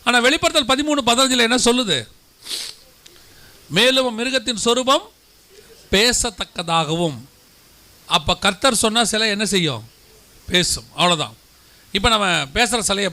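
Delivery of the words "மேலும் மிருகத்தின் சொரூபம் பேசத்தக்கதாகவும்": very slow verse quote; slow quoting. Acted. Preacher quotes the verse once more to the congregation. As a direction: draw out பேசத்தக்கதாகவும் slowly.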